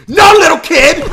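high, loud cry of protest; alarmed shout. Real, not acted. Shouting at a game character.

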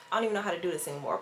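rushed flustered mumble; fast. Retold or imitated. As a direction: rattle it off fast. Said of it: "I'm like".

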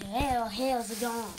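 very loud, drawn-out HELL; mock your own outburst, stretching it loudly; exaggerated self-imitation. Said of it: "I don't know why I s-, said".

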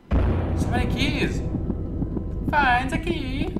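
loud, high, slow mock Italian; hammed-up Italian demon, drawn out and loud. Gamer voicing the demon about keys, loud high Italian voice. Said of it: "give him back the Italian voice".

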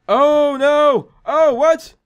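high, loud cry of dismay; startled gamer outburst; mock alarm. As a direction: exclaim in playful shock. Mock alarm at game attack.

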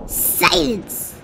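sharp barked command. A narrator's reading. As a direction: snap it like a tyrant king. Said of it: "barked back".

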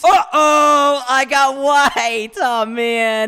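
loud, high, drawn-out dismay; loud mock despair, easing at the end; dismayed wail. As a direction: wail over your bad luck. Gets the colour they dreaded.

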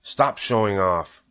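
understated, slow scolding; a hint of a reproving mother; mother's cry. A narrator's reading. Mother tells Avery to quit clowning, mildly scolding. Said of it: "cried his mother".

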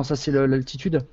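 ordinary delivery

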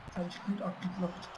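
usual speaking voice

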